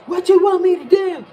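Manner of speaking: loud, high yell at the sky; retold yell. Lottery man yelling at the universe, loud and high. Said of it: "He yelled".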